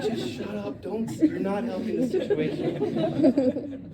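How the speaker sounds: high, slow mock-exasperated; hammed-up annoyed friend, laughing; high and slow, half laughing; Peter voice. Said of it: "so this is Peter".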